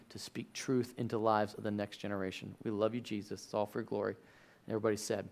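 soft, low prayerful voice. Real, not acted. Pastor praying softly over the church before the sermon. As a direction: pray quietly and earnestly.

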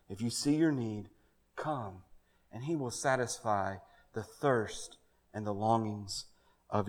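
soft, low invitation; quiet pleading close; gentle altar call. Acted. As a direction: invite them tenderly to come forward.

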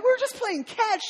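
high, sheepish excuse; husband explaining himself to wife; defensive dad. Retold or imitated. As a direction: plead innocent, a bit whiny. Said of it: "I'm like".

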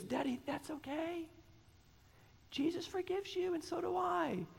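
put-on high, soft child voice; little daughter's voice. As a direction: play the seven-year-old girl gently forgiving.

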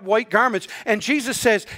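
ordinary delivery

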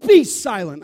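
loud, commanding rebuke; note of authority. Retold or imitated. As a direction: order the demon to silence with full authority. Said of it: "he said it with a note of authority".

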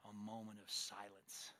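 scornful. Acted. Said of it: "What in the world is that gonna do?".

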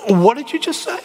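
high, incredulous what did you just say; hammed-up shock; mock disbelief. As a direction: act stunned by what you heard. Shocked reaction, rhetorical.

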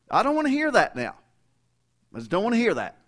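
loud, high, fed-up parent shutting it down; exasperated parent. Retold or imitated. Preacher voicing a fed-up parent silencing his son, loud and high. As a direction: raise your voice and cut him off, exasperated.

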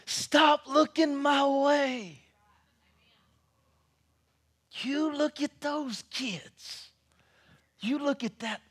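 high, slow shouted warning from afar; old man shouting across the camp; retold shout. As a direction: call it across the campsite, high and drawn out. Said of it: "he shouted back over here".

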